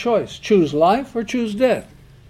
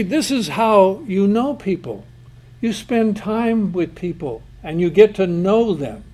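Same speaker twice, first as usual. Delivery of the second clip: usual speaking voice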